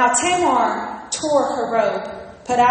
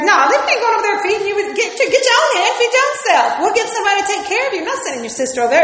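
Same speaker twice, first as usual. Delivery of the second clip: fast, high-pitched indignant refusal; comic rapid-fire telling-off, pitch jumping up; Pastor Levi; 7 semitones higher, 3 dB louder, much faster than usual. Retold or imitated. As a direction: rattle off a scolding as a fed-up father. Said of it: "If it was Pastor Levi".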